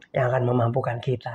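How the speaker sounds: normal talking voice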